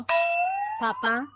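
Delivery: sing-song drawn-out call; long teasing call. Acted. Daughter teasing her father before calling out his line. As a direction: stretch papa out high and slow, playfully.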